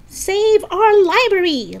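high, slow rallying shout. Acted. Lisa rallies the protest at the library, shouting. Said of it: "Lisa shouted".

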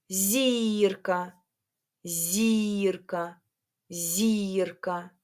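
ЗІРКА with a long stressed І; stress on first syllable. Shown as a demonstration. Teacher helping pupils hear the stress, first vowel drawn out. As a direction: lean on the І in ЗІРКА, drawing it out. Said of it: "на який голосний у вас впав наголос".

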